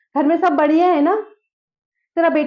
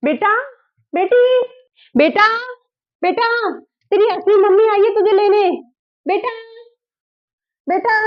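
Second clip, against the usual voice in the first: calling out; 6 semitones higher than usual. Acted. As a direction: call her in from afar. Calling daughter from another room.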